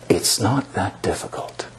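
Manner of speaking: whispering. Real, not acted. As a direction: whisper it gently. Quiet close of the sermon.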